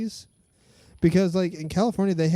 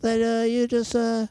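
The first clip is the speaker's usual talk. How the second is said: high, whining mock-liberal voice; whiny liberal voice; 7 semitones higher than usual. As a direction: whine it like an offended do-gooder. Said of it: "That's my whiny liberal voice".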